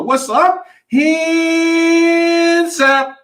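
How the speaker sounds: high, long held-out introduction; show announcer's call. Pretend. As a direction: sing out the guest's name like a ring announcer. Announcer brings guest on the show.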